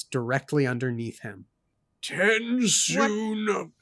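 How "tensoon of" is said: slow, high, lofty voice; slow and grand; lofty elder voice. Acted. Reading Kanpar's line aloud.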